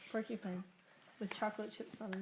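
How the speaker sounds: usual speaking voice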